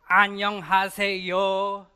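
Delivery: hammed-up American accent on a Korean greeting. Shown as a demonstration. Pastor mimicking how his Korean greeting sounds, exaggerated American accent. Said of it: "It's, it's this American accent".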